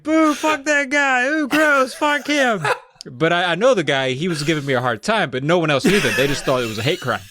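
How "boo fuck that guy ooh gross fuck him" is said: high, drawn-out mock jeers; booing crowd. Everyone in the club at Travis, mock booing. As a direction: heckle like a disgusted audience. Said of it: "everyone was like".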